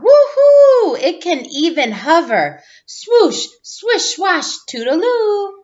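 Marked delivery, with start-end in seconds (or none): 0.0-1.0 a high, loud whoop of joy; excited woohoo. Acted. Child reading to class, cheering woohoo at the smash. 2.9-5.5 high swooshing sounds. Acted. Truck hovering sound effects.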